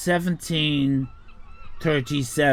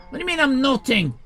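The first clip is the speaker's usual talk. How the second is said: indignant; 8 semitones higher, faster than usual. Real, not acted. Streamer offended by chat comment.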